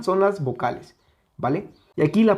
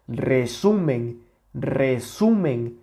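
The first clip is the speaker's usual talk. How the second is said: resumen slowly, middle syllable leaning then falling; stress on SU; much slower than usual. Shown as a demonstration. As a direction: stretch SU in resumen, let MEN drop. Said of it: "aquí se escucha la mayor intensidad".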